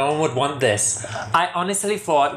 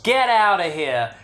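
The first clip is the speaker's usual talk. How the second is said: usual speaking voice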